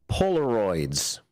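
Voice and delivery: drawn-out punchline delivery; slow punchline. Shown as a demonstration. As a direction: let the punchline land slowly.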